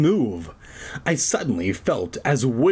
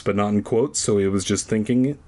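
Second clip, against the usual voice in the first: ordinary delivery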